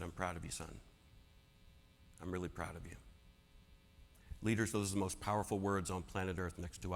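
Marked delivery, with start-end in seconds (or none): 0.0-3.0 put-on a dying mentor quietly telling his boy he's proud; soft tender pride. Mentor to his grown son, softly tender.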